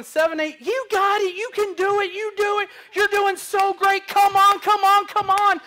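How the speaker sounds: high, loud cheering encouragement; excited mom cheering from the bedside, high and loud; cheerleader mom. Retold or imitated. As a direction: cheer her on like a natural cheerleader. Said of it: "my mom's a natural cheerleader".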